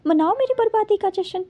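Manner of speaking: ordinary talk, nothing marked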